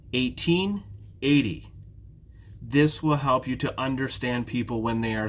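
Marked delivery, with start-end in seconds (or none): 0.1-0.8 Eighteen with the second syllable lifted higher; stress on TEEN. Shown as a demonstration. Teacher contrasting similar numbers for listeners, stress on "teen" in "Eighteen". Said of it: "listen for that stress". 1.2-1.7 eighty with a strong first syllable; stress on EIGH. Shown as a demonstration. Teacher contrasting similar numbers for listeners, stress on first syllable of "eighty". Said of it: "listen for that stress".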